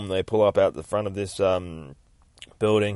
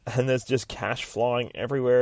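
ordinary delivery

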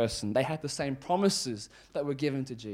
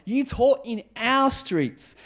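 high, slow, emphatic appeal; indignant protest; 9 semitones higher, 4 dB louder, slower than usual. Acted. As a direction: plead your case, affronted. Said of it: "how the people here would have felt".

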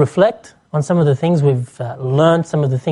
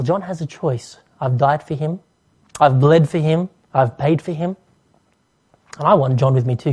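ordinary delivery

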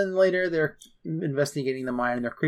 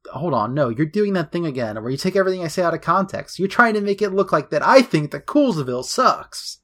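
rising, louder, increasingly worked-up mock rant; mock indignation climbing higher; exasperated protest; 5 semitones higher, 5 dB louder than usual. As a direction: imitate a flustered interviewee protesting, building to a peak. Fred protesting in interview.

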